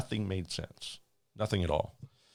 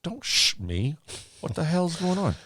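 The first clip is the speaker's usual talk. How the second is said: higher, louder exasperated demand; retold angry demand; 10 semitones higher, 5 dB louder than usual. Flight attendant demanding answers from the silent pilot, angrily. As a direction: push back hard at being shushed.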